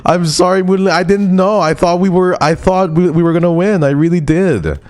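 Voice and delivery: high cutesy put-on voice, saying sorry; cutesy character voice. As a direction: play a sweet little shop character apologizing.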